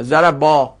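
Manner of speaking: "ضربا" said zarabaa, ending on a long open a; fatha before alef. Shown as a demonstration. Teacher sums up the rule, "ضربا" as zarabaa with fatha. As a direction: hold the final a in "ضربا", zarabaa. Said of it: "قبل الف فتحه میاد".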